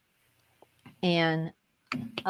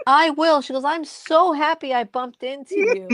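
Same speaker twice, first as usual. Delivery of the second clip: higher, louder mock gushing; delighted gushing; 5 semitones higher, 4 dB louder than usual. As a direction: imitate a thrilled stranger. Retold delighted stranger's reply.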